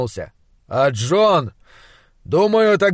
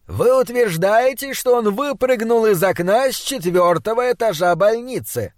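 высоким голосом, с нажимом переспросить; высокий, изумлённо-вопросительный тон оппонента; недоверчивый допрос; 6 semitones higher than usual. A narrator's reading. Оппонент допрашивает доктора на публике. (недоверчиво, с вызовом)

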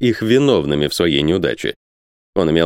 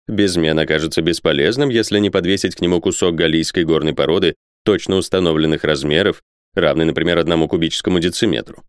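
plain reading voice

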